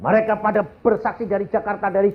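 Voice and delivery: high-pitched, projected preacher's delivery; impassioned preaching. Real, not acted. Preacher reports many testimonies to the congregation, impassioned and high.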